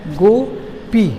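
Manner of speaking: "Gopi" split slowly, गो, pause, पी; two separate breath strokes on "गोपी"; दो धक्कों में. Shown as a demonstration. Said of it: "तो दो बार धक्का लगा". ("गोपी" को धीरे, दो खंडों में तोड़कर)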